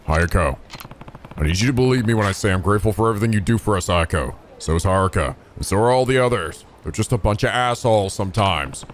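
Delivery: deep, gentle reassuring voice; Kiryu voice. Acted. Kiryu reassuring Ayako she is loved, deep and gentle. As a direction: comfort her like a caring uncle.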